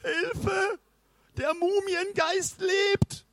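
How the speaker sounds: very high, stretched wail for help; panicked cry. A narrator's reading. Toni alerts the village about the mummy, high drawn-out cry. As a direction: cry out in terror without much volume. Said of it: "schrie Toni".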